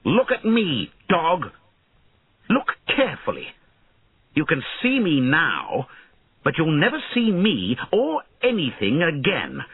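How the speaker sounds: high-pitched, menacing orders; cruel command. A narrator's reading. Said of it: "He smiled cruelly at Redbeard".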